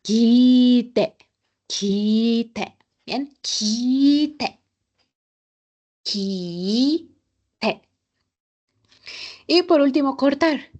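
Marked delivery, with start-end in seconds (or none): 0.0-2.8 "kite" with the "i" held long, "kiii-te"; drawn-out "kiiite". Shown as a demonstration. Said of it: "en japonés se va a alargar". 3.4-7.8 "kite" with a long sustained "i"; slow, stretched "kiiite". Shown as a demonstration. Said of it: "pero la I".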